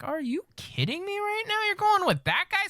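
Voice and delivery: high-pitched, disbelieving mock outrage; mock incredulity. Imagined quarterback's incredulous reply.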